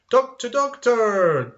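loud, high call for the doctor; acted urgent call, pitched high. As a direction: cry out like a patient summoning help. Acts a patient calling the doctor.

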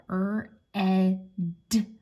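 "er, eh, d," as three separate sounds; sounding out red. Shown as a demonstration. Teacher spelling red by ear, each sound apart. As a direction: break red into "er, eh, d,". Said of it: "There's my word red".

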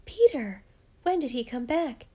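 high, loud excited outburst; excited cry, name punched loudest. A narrator's reading. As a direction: burst out with an eager question. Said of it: "crowded around him in excitement".

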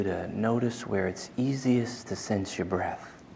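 slow, soft voice guiding the breath; guided meditation. Acted. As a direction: ease the class into stillness, slowly and softly.

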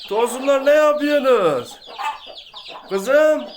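high, loud call across the yard. Real, not acted. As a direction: call out to the hens. Calling to the hens in the coop.

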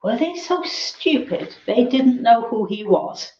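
ordinary delivery, nothing marked

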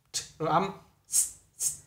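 t and s hissed alone, quiet and unvoiced; isolated sounds. Shown as a demonstration. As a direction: sound out t then a soft hiss s. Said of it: "Âm rồi, âm t".

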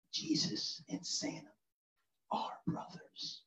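slow hushed whisper. Retold or imitated. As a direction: whisper it like a big secret. Daughter reveals secret from grandma.

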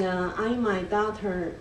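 usual speaking voice

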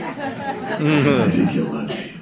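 slow, lightly put-on tough-guy rasp; a hint of slow movie tough guy; Clint Eastwood. Older dad joking he feels like a movie tough guy, light impression. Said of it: "a little bit like Clint Eastwood".

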